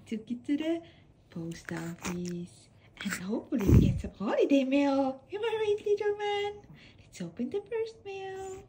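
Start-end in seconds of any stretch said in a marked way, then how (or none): none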